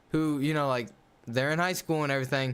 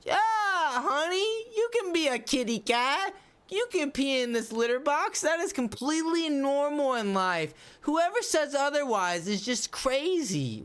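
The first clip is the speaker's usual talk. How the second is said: mocking indulgent parent; 9 semitones higher than usual. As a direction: parody a parent coddling a kid who thinks he's a cat. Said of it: "grow up and be a fucking cat".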